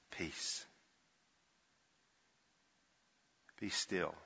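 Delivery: a near-whisper, soft and still; barely voiced, hushed and calm; hushed command. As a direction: breathe the words out to calm the sea. Jesus calming the storm.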